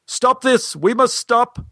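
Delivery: high, urgent director's call; booming interruption. Retold or imitated. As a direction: imitate a director halting the show. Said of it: "a voice boomed out from the back of the theater".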